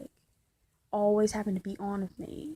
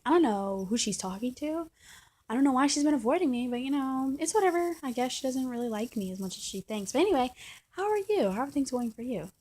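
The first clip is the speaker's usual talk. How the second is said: imitating her in a higher-pitched voice; mock passive-aggressive girlfriend; 5 semitones higher than usual. Girlfriend answering how her day was, mock passive-aggressive. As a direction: put on fake breeziness over a grievance.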